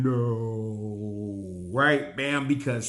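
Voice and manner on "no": long drawn-out, low, softer no; slow-motion no. Retold or imitated. Recapping the slow-motion shot at Magneto, mock slow-motion no. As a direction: stretch it out like a slow-motion cry, dropping low. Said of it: "they do their slow motion".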